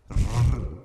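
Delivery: a long tongue-tip trill, low; rolled r trill. Shown as a demonstration. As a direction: roll the R on its own. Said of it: "Ele tem esse som". (R vibrante prolongado)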